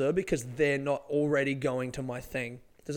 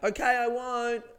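slow, high, mocking brush-off; lightly mock the guy walking away, slowly; dismissive passerby; 9 semitones higher, 4 dB louder, slower than usual. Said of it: "some guy walking away just went".

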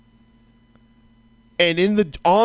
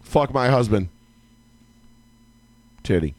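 much lower, flat stone-cold voice; deadpan wife; 5 semitones lower than usual. Retold or imitated. Wife on the Jumbotron ignoring her husband, low and deadpan. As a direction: drop it low and emotionless.

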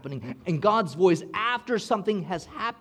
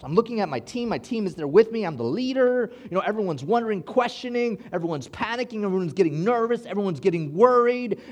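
rising panic; 6 semitones higher, 4 dB louder than usual. Retold or imitated. Retelling panic on plane.

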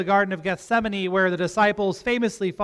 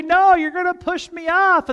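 high, loud playful refusal; mock protest; 10 semitones higher, 8 dB louder than usual. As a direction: protest to the kids in mock alarm. Acts his protest to sons.

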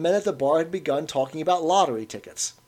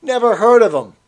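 curt, louder reply; short barman; 9 semitones higher, 12 dB louder than usual. A narrator's reading. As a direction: cut the old man off. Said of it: "said the barman shortly".

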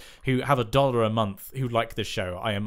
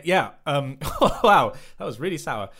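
ordinary talk, nothing marked

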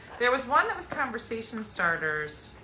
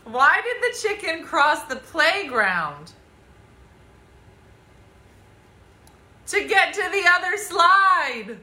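hammed-up corny riddle, louder; embarrassing dad joke; 5 semitones higher, 5 dB louder, slower than usual. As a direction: deliver the punchline proudly. Reading dad joke riddle aloud.

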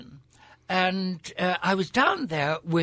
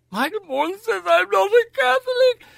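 high, loud child's wail, hammed up; mock tearful wail; 5 dB louder than usual. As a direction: wail it like a child running to mother in floods of tears. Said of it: "I went home in floods of tears to my mother".

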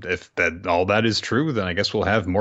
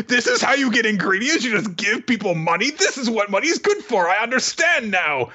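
high, hammed-up character voice; excited revelation; 13 semitones higher than usual. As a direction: proclaim it as a huge discovery, pitched way up. Character's acted shouted revelation.

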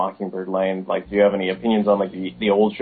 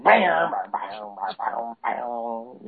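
imitating opening music; much slower than usual. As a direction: hum a cheesy rock intro. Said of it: "it's like".

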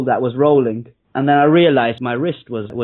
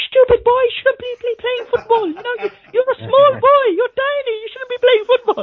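mum screaming. Retold or imitated. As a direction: shriek it like a furious mum. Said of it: "my mum was, like, screaming at me".

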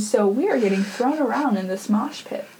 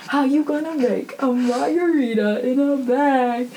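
laughing repeat; 4 semitones higher than usual. Retold or imitated.

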